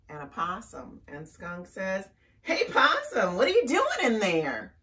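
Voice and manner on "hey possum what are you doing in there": high, loud call to a friend. A narrator's reading. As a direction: call out brightly as a storybook skunk. Skunk calls out to Possum.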